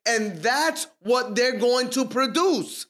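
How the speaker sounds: ordinary delivery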